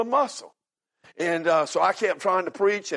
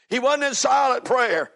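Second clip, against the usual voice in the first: high, raised pulpit voice; emphatic, high-pitched sermon delivery; fervent preaching; 6 semitones higher than usual. Real, not acted. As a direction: preach it with rising urgency.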